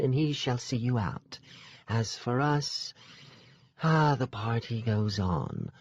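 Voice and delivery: lightly voiced aloof royal woman; regal fae sister. Sister closes the deal with the hero, aloof. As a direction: dismiss them with cool grace.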